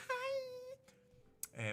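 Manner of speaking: very high, tiny timid "Hi"; shy anime girl. Pretend. Greeting the farmer as a shy anime girl, very high and timid. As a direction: squeak it out like a bashful anime girl. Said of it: "the shy anime girl".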